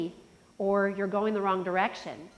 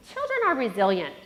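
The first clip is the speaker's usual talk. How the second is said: normal talking voice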